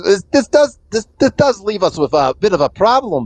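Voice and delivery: lightly voiced rat crime boss. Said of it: "she says".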